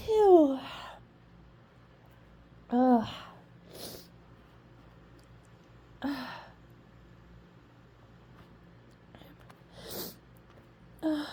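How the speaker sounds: stifled sneeze groans. Real, not acted. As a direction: choke down a sneeze. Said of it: "really stuffy now".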